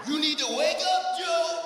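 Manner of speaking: high mocking shout; yelling at Joe. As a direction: holler at him to wake up. Yelling at Biden.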